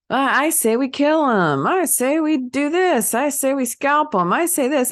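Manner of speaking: high-pitched, hammed-up mob voices; mock angry bikers. Bikers debating what to do with him, mock outrage. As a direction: imitate a gang calling out one verdict after another.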